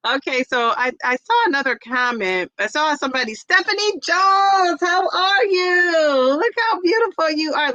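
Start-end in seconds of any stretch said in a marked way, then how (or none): 3.3-7.7 high, slow, gushing cry of delight; slow, high and hammed-up delight; gushing greeting. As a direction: read it like a thrilled friend spotting her. Reading gushing comment aloud.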